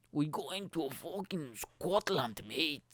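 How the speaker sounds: slow, badly faked Scottish accent; attempted Scottish accent. Podcaster setting off for Scotland, muddled Scottish accent. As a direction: attempt Scottish, drifting towards Australian. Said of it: "the accent that I'm trying to do is".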